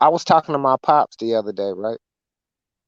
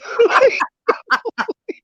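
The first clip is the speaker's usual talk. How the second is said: high squealing laugh. Real, not acted. Recalling girlfriend's insult.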